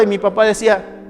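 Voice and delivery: usual speaking voice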